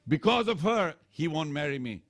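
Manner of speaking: high, loud jealous outburst; jealous demon woman. Acted. Shurpanakha furious at Ram over Sita, high loud jealous outburst.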